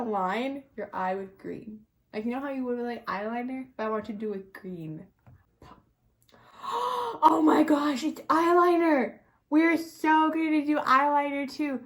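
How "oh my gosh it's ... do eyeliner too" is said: loud, high, drawn-out delight; excited discovery. Real, not acted. Vlogger discovering eyeliner in the makeup kit, excited. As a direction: gush slowly and loudly at a thrilling find.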